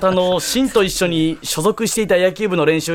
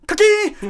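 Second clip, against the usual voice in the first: sharp, high, shouted bat crack; 12 semitones higher, slower than usual. Acted. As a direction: belt out the bat sound.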